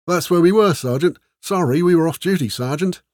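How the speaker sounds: high-pitched, overlapping excuses to a sergeant; blurted excuses. A narrator's reading. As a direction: blurt it out like flustered soldiers. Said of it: "we blurted over each other".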